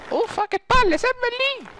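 put-on very high kid voice; whiny child voice. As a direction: act a whining little kid. Child voice continues.

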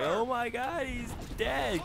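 high-pitched imitation of panic; mock freaking out. Player imitating squadmates freaking out, high mock panic. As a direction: wail it high, mocking their overreaction. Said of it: "everyone's freaking out like".

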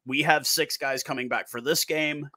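ordinary talk, nothing marked

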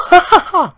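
high, loud burst of laughter; real laugh. Real, not acted. Gamer who scored without knowing how, loud laugh. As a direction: crack up helplessly.

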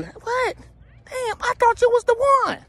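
very high letdown voice; high disappointed squeal. Acted. Disappointed in partner.

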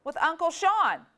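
put-on high, insistent child's voice; insistent little girl. Little daughter insisting to her mother, high and stubborn. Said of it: "and Emily goes".

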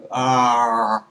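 "R" pushed from a tightened throat; throat-narrowed r. Shown as a demonstration. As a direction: say "R" as if being choked. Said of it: "cổ của mình nó sẽ khép lại". ("R" phát ra từ cổ họng thắt lại)

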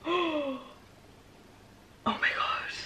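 whispered, stunned disbelief; awed whisper. Real, not acted. Fan opening the gift up, whispering in awe. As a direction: barely whisper it, with a pause after "Oh".

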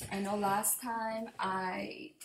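ordinary delivery

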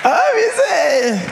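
high, slow drawn-out shout; hammed-up high I told you; mock warning shout. Warning the crowd comically.

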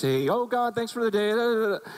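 high, breezy throwaway prayer; imitating a careless routine prayer; mock casual prayer. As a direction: toss off a lazy, rote prayer. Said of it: "it's not just".

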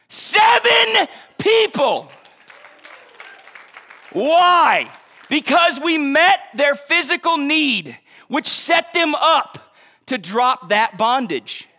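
loud, slow, high-pitched proclamation; full-force preaching. Acted. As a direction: punch out each phrase to the congregation, drawn out.

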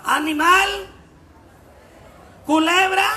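loud high shout, one word at a time; shouted heckle; shouted insults. Real, not acted. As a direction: bark each insult out at her.

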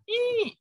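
very high squeaked "ee"; thin, high "eee" showing pitch going up; rising pitch demo. Shown as a demonstration. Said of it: "Jadi late-nya naik".

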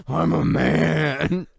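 slow, drawn-out mock declaration; hammed-up defiant line. Braddock refusing the doctor, slow and hammed-up. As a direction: stretch it out like a bad movie hero. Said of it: "that's what he said".

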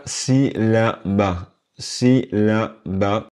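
"sílaba" very slow, three equal separated syllables; sans accentuation. Shown as a demonstration. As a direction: split "sílaba" into si, la, ba with no stress. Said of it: "je fais des efforts pour ne pas accentuer". (« sílaba » très lent, trois syllabes égales, sans accent)

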